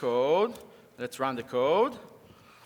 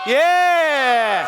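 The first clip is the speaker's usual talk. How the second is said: 1 cheer; 6 semitones higher, 7 dB louder than usual. Real, not acted. Cheering kid's effort.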